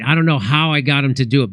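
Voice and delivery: normal talking voice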